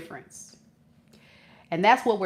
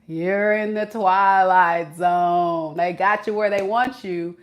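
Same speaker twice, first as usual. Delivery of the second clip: usual speaking voice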